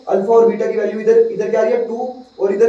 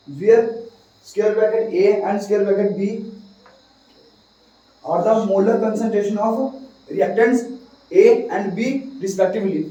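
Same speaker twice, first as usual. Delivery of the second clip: slow dictation pace; slowly, word by word for notes; dictating; much slower than usual. Shown as a demonstration. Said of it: "लिख दीजिए".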